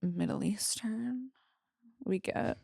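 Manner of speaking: quiet trailing off; hushed. Real, not acted. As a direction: drop to a near whisper. Trailing off softly.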